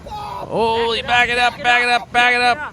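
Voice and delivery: high, loud shouts of back it up; urgent yelling. Real, not acted. Shouting at the boaters in the video, high and urgent. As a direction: yell at the boat to reverse. Urging boaters away from splash.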